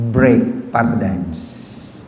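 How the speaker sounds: slow emphasis. Shown as a demonstration. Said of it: "I want to over-communicate".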